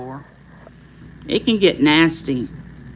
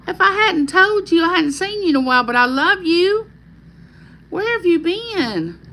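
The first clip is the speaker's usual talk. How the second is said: gushing greeting; 10 semitones higher than usual. Real, not acted. Livestream host welcoming a viewer not seen in a while, gushing. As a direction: greet a long-lost friend in a high, delighted coo.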